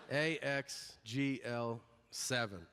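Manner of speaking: slow, deliberate letter-by-letter reading; arrogant confidence. Retold or imitated. Cocky patient reading the eye chart to the clerk, arrogant. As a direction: read each one out slowly, sure of yourself. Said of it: "I was still arrogant about it".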